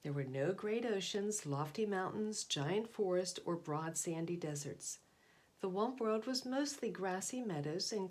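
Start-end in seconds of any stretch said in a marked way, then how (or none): none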